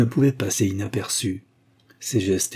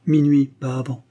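detached; 4 semitones higher, 4 dB louder than usual. A narrator's reading. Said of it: "avant de répondre avec détachement".

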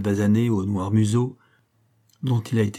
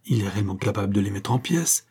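plain reading voice

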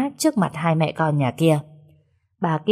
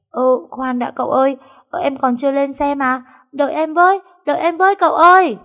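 high, rising loud call, faster at the end; panicked, chasing him; calling after; 9 semitones higher, 4 dB louder than usual. Acted. Sen running after departing master. (gọi với theo hốt hoảng)

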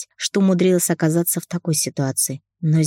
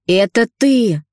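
higher, louder furious shout; angry accusation; 5 semitones higher, 5 dB louder, slower than usual. A narrator's reading. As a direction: jab the words at her in rage. Accusing thief, pointing.

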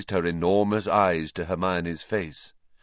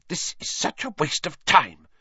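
higher, sharp Hermione whisper-hiss; 8 semitones higher than usual. A narrator's reading. Hermione hissing to Harry, impatient and sharp. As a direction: hiss it impatiently under breath. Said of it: "Hermione hissed".

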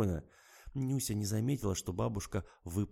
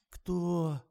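yawning; 9 semitones higher, 3 dB louder than usual. A narrator's reading. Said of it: "зевнула Фатима".

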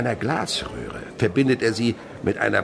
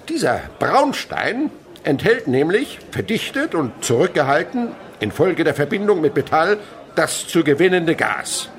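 pompous lecturer; 7 semitones higher, 6 dB louder than usual. Acted. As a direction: lecture as if from a pulpit. Chemist lecturing pupils theatrically.

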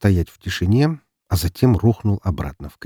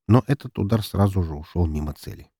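ordinary delivery, nothing marked

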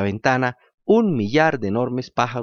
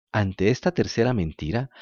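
ordinary delivery

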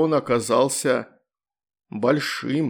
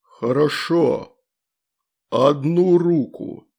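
very slow, ponderous monster voice; demon voice; much slower than usual. A narrator's reading. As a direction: pronounce terms like a demon. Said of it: "а потом молвил".

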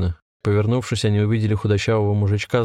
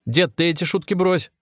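much higher-pitched restrained shout; 9 semitones higher than usual. A narrator's reading. Anatoly warns the old man, high-pitched mild shout. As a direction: call him off with raised pitch. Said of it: "крикнул также Анатолий".

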